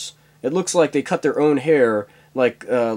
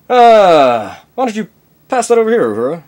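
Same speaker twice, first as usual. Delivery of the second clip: higher, louder captain voice; captain's demand; 8 semitones higher, 8 dB louder than usual. Acted. Captain tells Uhura to pass the joint, commanding.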